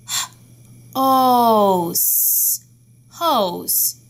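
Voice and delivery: segment and blend hose; teacher modelling sounds of hose; sounding out. Shown as a demonstration. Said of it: "Tell me the sounds of hose".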